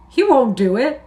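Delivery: loud, high protest; cried out. A narrator's reading. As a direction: blurt it out in alarm. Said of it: "Lenny cried".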